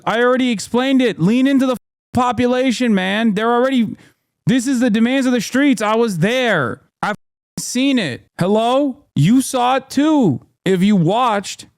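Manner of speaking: exasperated, driving the point home; fired-up rant at the governor; heated rant. Real, not acted.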